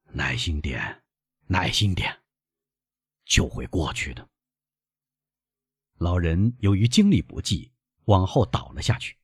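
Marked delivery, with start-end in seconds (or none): 0.1-4.3 put-on low, soft old man; ailing old father. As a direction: weakly reassure your son in a lowered voice. Ailing father calms his son.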